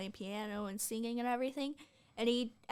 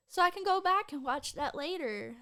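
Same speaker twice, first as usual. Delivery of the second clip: retold optimism; 6 semitones higher, 4 dB louder than usual. Mimicking own optimism about watch list.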